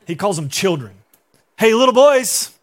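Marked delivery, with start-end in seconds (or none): 1.6-2.5 loud, higher-pitched shout across the water; calling out. Acted. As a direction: holler to get their attention. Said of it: "Jesus calls out to them".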